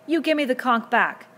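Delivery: high, loud, drawn-out demand; slow, loud, indignant order; angry demand. A narrator's reading. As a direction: demand it back in a squabble. Tussle over the conch.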